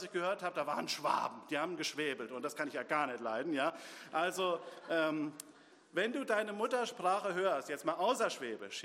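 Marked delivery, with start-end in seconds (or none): none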